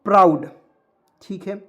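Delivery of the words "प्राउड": प्राउड said loud and clear as "praud"; clear model word. Shown as a demonstration. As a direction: model प्राउड loudly as "praud" with a falling tune. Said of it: "देखिएगा थोड़ा सा ध्यान से".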